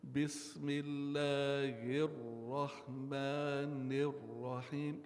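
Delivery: slow chanted Bismillahirrahmanirrahim, two points pressed harder; recitation with emphasis. Shown as a demonstration. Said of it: "there are certain places I put emphasis".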